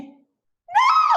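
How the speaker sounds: very high, loud wail of no; dismayed cry. Real, not acted. Host finding the guest still inaudible, high dismayed shout. Sound check fails, host cries out.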